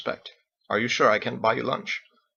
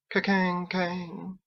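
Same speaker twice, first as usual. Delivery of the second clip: slow, higher, softer ringing imitation; bell toll; 7 semitones higher, 4 dB quieter, much slower than usual. Narrator imitating the church bell tolling, slow and soft.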